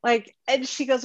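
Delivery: usual speaking voice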